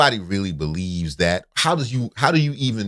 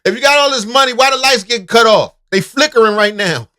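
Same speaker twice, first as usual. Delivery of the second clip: high, loud mocking taunt; 12 semitones higher, 6 dB louder than usual. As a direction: taunt him, pitched high. Mocks the broke host.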